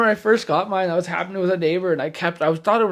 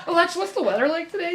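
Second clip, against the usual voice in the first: teasing relative; 10 semitones higher, faster than usual. Retold or imitated. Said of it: "aunt and uncle do that".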